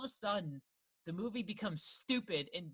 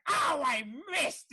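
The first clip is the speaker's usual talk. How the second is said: drawn-out, high, loud mock yell; mock scream; 8 semitones higher, 8 dB louder, much slower than usual. Podcaster mimics an actor missing a basket, mock scream. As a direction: bellow it slowly, hamming up the actor. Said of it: "screams every line of dialogue".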